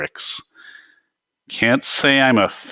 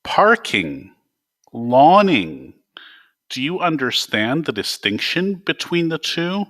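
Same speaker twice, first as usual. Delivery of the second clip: higher, exasperated lecturing of the obvious; 8 semitones higher than usual. Acted. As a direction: spell out the difference like to a child, fed up. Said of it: "I raised my arms in exasperation".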